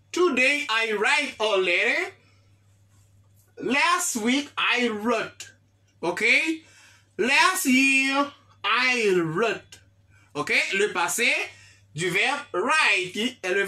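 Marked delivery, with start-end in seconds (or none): 3.6-5.5 slow model sentence. Shown as a demonstration. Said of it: "teacher models slowly". 7.2-9.8 slow model sentence. Shown as a demonstration. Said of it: "teacher models slowly".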